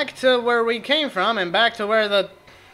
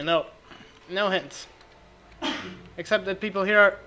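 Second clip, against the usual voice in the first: normal talking voice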